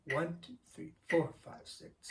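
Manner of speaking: One, two, three counted softly in time; counting beats. Shown as a demonstration. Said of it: "That's how it's gonna fall".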